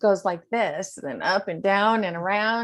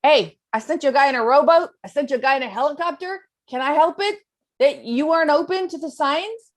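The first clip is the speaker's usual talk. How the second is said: God answering; 7 semitones higher, 4 dB louder, faster than usual. Retold or imitated. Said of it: "And God says to him".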